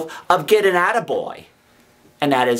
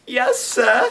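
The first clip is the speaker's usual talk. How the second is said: put-on frightened boy; 11 semitones higher than usual. Frightened boy answers convict.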